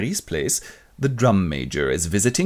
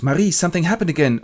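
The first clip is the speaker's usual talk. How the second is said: fast, higher anxious rush; hurried higher voice; 8 semitones higher, 3 dB louder, faster than usual. Retold or imitated. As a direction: blurt it out fast. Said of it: "and he goes".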